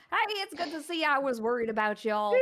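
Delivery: big farmhand greeting the party in a hillbilly twang; hillbilly accent. Acted. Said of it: "Hillbilly, right?".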